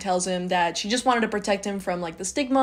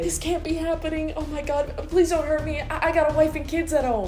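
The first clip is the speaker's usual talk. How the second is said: higher, pleading bank teller; panicked victim; 7 semitones higher than usual. Pretend. Robbery victim begging the robber not to hurt her, panicked and high. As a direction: beg for your life, mock panic.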